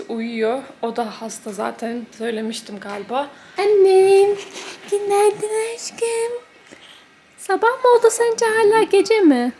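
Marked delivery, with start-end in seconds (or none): 3.6-9.5 tiz, yavaş, sevecen anne sesi; bebeğe tatlı tatlı seslen; bebek sesiyle sevgi. Real, not acted. (bebeğe tiz sesle sevgiyle konuşma)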